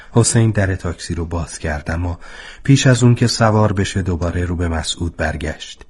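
ordinary delivery

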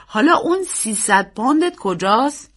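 loud, lightly amused question; amused uncle. A narrator's reading. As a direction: humour the child, mildly amused. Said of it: "با خنده گفت".

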